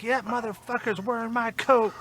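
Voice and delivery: high-pitched mock rage at the stolen coat; mock raging old man. Retelling Pa raging over his coat, high mock grumble. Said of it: "Pa's raging in back".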